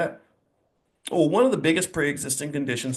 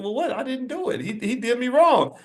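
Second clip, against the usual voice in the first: put-on higher, whiny nine-year-old protest; nine-year-old protesting to his mother, voice pushed higher; kid protesting; 10 semitones higher than usual. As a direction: plead your innocence like a wronged kid. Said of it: "And I said".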